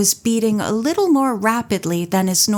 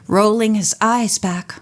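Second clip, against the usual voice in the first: plain reading voice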